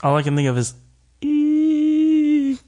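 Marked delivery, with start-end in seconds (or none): 1.2-2.6 high tea kettle squeal; rising squeal. Acted. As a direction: let it rise like a kettle.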